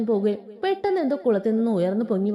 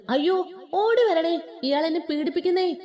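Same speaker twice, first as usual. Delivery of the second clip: calling for help; 7 semitones higher than usual. Acted. Girl threatens the hero by crying out for help. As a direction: holler it out to bring people running. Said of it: "ഞാൻ വിളിച്ചു കൂവും".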